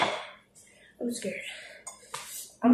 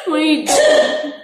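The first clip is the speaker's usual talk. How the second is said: crying with laughter; laughing; 2 semitones higher, 4 dB louder than usual. Real, not acted. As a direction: laugh slowly through the question. Laughing at sibling's reaction.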